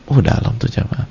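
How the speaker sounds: ordinary delivery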